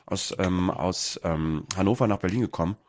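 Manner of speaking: talking while chewing a snack; mouth full. Real, not acted. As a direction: speak around a mouthful of food. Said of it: "Entschuldigung, dass ich was knabber".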